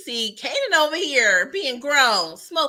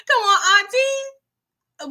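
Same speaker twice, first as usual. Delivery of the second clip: high, loud, crying whine; whiny plea; 11 semitones higher, 5 dB louder, much slower than usual. Retold or imitated. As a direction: draw it out like a wounded teen. Juke pleading with auntie.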